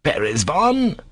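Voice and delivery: high, perplexed echo of a name. A narrator's reading. Doctor repeats the dog's name, perplexed. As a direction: repeat it as a baffled question.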